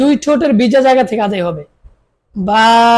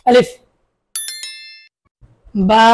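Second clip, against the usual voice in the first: usual speaking voice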